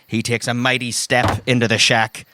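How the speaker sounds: normal talking voice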